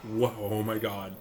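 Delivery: ordinary talk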